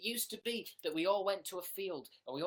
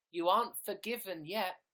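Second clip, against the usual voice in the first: ordinary talk, nothing marked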